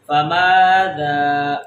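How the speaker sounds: فماذا slow and low, both aa held; short fa, then stretch the rest of فماذا; long vowels. Shown as a demonstration. Teacher models alif madda, فماذا drawn out. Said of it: "میم کو بھی لمبا کر رہے ہیں".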